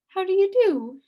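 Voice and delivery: high, loud put-on old lady greeting; the witch greeting Milo; polite old lady. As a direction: greet sweetly.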